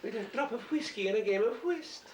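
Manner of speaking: high, lilting Highland Scottish lady voice; a genteel Highland lady, laughing through it; Highland accent. Shown as a demonstration. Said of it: "That's the Highland accent".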